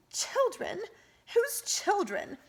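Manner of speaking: high, sharp demand thrown back. A narrator's reading. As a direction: fling the word back at them.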